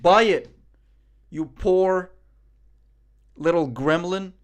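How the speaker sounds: mock insult. Mock-scolding a chat freeloader.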